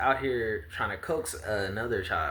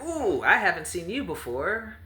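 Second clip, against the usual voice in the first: bright high greeting, much higher; high cheery voice; 10 semitones higher, 3 dB louder than usual. Acted. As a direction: call out sweetly in a high voice. GM voices promised child greeting.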